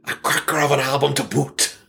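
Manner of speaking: "a cracker of an album to boot" in a light, amateur Irish lilt; a playful, half-confident Irish accent; attempted Irish accent. Shown as a demonstration. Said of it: "I wish I could do an, an Irish".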